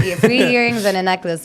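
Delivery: ordinary talk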